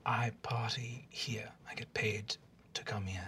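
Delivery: small frightened whisper; whispered reply. Acted. Captive student whispering back to her interrogator. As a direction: answer barely audible. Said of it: "as the young student said".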